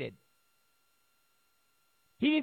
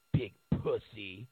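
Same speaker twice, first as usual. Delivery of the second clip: much lower, slow sneering taunt; mock press jeer; 13 semitones lower, 3 dB quieter, slower than usual. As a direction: drag out the insult low and scornful. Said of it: "the press is like".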